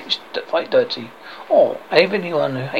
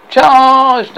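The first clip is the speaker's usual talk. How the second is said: loud, high, drawn-out command; battle cry; 8 semitones higher, 10 dB louder than usual. Acted. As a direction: call the knights to attack. Arthur orders the attack.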